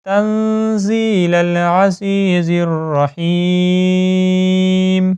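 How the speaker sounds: slow melodic tajweed chant; Quran recitation. Shown as a demonstration. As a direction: recite it slowly with ghunna. (قرآن کی تجوید کے ساتھ آہستہ تلاوت)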